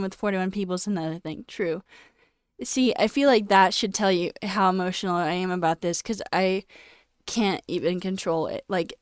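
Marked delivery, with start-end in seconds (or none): none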